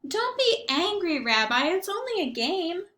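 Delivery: a cheerful, half-deaf grandmother greeting her guest; Baba Brena. Acted. Grandmother greeting the rabbi at her door, chirpy old lady voice.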